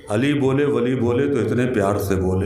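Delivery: low, restrained first reading; संयत शेर-पाठ. Acted. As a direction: introduce the verse in a low, contained voice. शेर की पहली पढ़त. (धीमे सुर में शेर पढ़ना)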